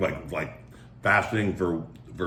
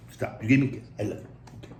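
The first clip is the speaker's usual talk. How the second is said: ordinary talk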